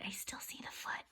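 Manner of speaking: quiet aside in suspense; soft whisper; hushed whisper. A narrator's reading. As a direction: whisper it softly. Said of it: "hushed to children".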